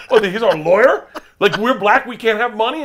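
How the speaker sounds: loud, high mock outrage; Tracy Morgan, indignant. Comic plays the star confronting a waitress over the bill, indignant. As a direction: challenge them with offended disbelief.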